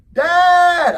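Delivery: put-on very loud, high kid's shout; a child bellowing in protest. Son yelling back.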